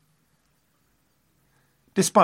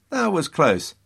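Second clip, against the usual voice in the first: mock relief; 7 semitones higher than usual. Relieved thought of Lord Clinton, voiced.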